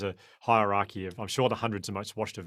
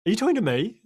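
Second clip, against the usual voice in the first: raised, high-pitched challenge back; indignant retort; 12 semitones higher, 8 dB louder than usual. Retold or imitated. Runner turning round to the yelling official, indignant. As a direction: throw the question back loudly, acting out disbelief.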